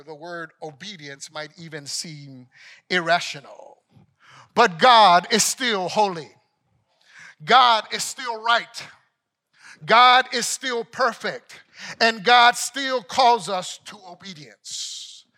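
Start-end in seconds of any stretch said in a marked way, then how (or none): 4.5-15.2 loud, high, rising sermon climax; preaching at full force. Acted. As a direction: preach it out, building with every repeat. Preacher declaring God's holiness.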